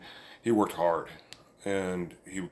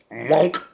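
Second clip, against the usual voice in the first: popping sound; 6 semitones higher, 7 dB louder than usual. Retold or imitated. Tooth pulled out sound.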